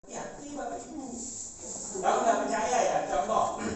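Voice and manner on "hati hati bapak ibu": hushed warning. Real, not acted. Warning the audience.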